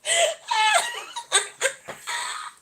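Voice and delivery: very high, helpless squealing laugh; shrieking laugh. Real, not acted. Streamer seeing own face close up, shrieking laugh.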